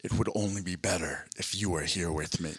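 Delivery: low, soft seductive murmur. Pretend. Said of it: "and then I'm like".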